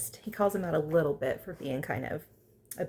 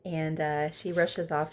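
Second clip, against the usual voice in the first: normal talking voice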